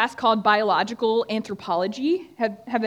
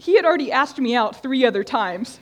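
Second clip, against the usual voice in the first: normal talking voice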